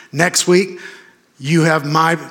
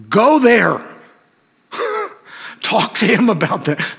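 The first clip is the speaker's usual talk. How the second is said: urgent exhortation; 8 semitones higher than usual. Real, not acted. Preacher urging the congregation.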